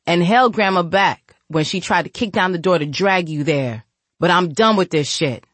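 loud, fed-up big brother; angry lecture. Acted. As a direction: scold her, firm and loud. Shadow lectures his sister.

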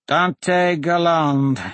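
very slow, high and reedy. A narrator's reading. Remaining man names his attacker, high reedy voice. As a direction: draw the name out in a reedy high voice. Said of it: "the high, reedy voice from the stream".

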